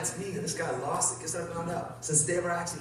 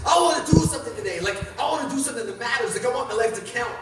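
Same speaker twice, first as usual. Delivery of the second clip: raised, louder pleading cry; retold scream skyward; 5 semitones higher, 5 dB louder than usual. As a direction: cry out to the sky, without a full scream. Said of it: "I screamed to the sky".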